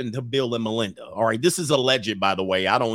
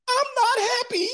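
tiny falsetto squeak, much higher; squeaky mock whine. Wife whining to husband she is not happy, falsetto squeak. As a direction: squeak it like a petulant child.

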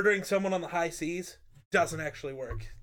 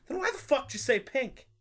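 high, baffled challenge; mock outrage; 8 semitones higher than usual. Comedian catching a woman lying about pink, mock outrage. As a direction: demand an explanation, acting exasperated.

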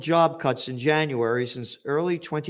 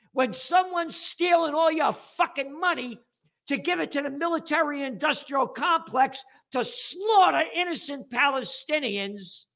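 furious rant; 6 semitones higher than usual. Real, not acted. Host shouts back at listener who complained about cursing. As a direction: yell it down at a prissy critic.

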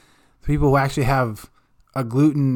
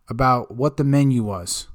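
ordinary talk, nothing marked

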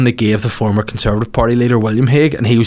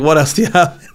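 mock Putin; 5 semitones higher than usual. Putin voiced in a story.

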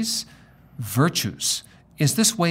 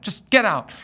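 a retold sharp, louder command; curt order; 8 semitones higher, 5 dB louder than usual. Gate agent ordering him out of the boarding line, curt and harsh. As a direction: snap it like an annoyed gate agent. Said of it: "She says, just like that".

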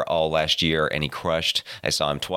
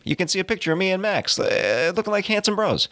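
excited; 13 semitones higher than usual. Acted. Hyped social media plug.

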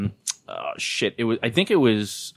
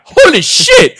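far higher, loud shocked shout; mock astonished yell; 13 dB louder, slower than usual. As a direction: shriek in disbelief. Fan sees Terminator returned.

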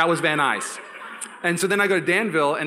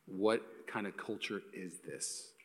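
stunned hush; 7 semitones lower, 13 dB quieter, much slower than usual. Retold or imitated. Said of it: "And he's like".

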